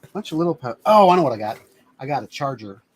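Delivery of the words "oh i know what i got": sudden realization. Real, not acted. Sudden recollection.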